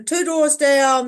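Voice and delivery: normal talking voice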